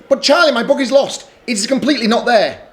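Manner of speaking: frantic little sister; distressed Lola. Acted. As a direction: cry out that the book is gone. Lola distressed book lost.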